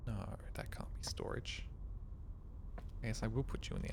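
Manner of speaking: whispered to himself; quiet whispered doubt. Real, not acted. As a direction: hush it, thinking aloud. Puzzling quietly over the game.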